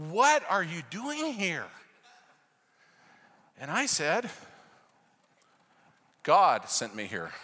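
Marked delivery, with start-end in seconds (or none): 0.1-1.7 astonished question. Retold or imitated. Students astonished at the foreigner. 6.2-7.3 low, loud, slow proclamation; dramatic. Shown as a demonstration. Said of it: "Not quite that dramatically".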